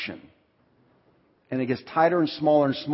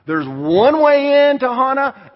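emphatic warning; 9 semitones higher, 6 dB louder, slower than usual. Retold or imitated. Continues warning.

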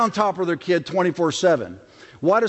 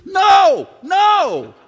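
high, loud shrieking no, no; panicked mom shout; 12 semitones higher, 7 dB louder than usual. Retold or imitated. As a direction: yell like a mom seeing a chainsaw. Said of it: "she goes".

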